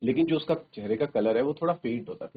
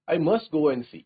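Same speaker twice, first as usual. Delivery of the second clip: louder and higher, pressing to go; urgent cry; 5 semitones higher, 8 dB louder, much slower than usual. Acted. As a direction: insist on going to the baby. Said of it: "Hannah cried". (हैना की बेचैन ऊँची पुकार)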